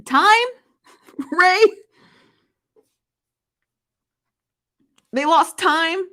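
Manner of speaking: shrill incredulous laughing disbelief. Real, not acted. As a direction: cannot believe what you read. Incredulous at comment's wording.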